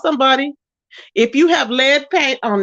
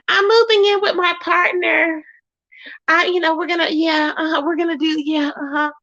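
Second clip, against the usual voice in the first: raised, mock-breezy imitation of the partner; mocking mimic; 5 semitones higher than usual. Mimicking the partner to viewers, higher and sing-song. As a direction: parrot his excuse back, mockingly.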